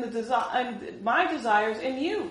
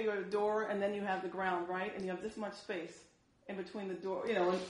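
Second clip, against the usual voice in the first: ordinary talk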